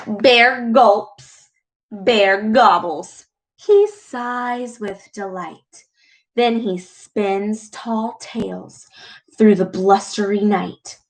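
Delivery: gentle storyteller voice; read-aloud storytelling. A narrator's reading. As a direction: read it like a bedtime story to kids. Reads picture book aloud.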